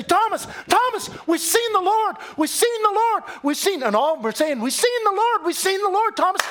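high, loud, jubilant cries of we've seen the Lord; excited shout. Acted. Disciples crying out to Thomas they've seen the Lord, excited shouting.

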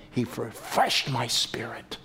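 soft unvoiced whisper, slowed; hushed reverent whisper; whispered tenderly. Real, not acted. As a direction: let it fall to a hush. Tender climax line.

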